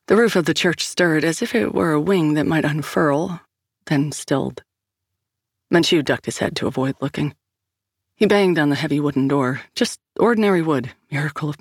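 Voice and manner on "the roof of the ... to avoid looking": ordinary delivery, nothing marked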